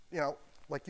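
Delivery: normal talking voice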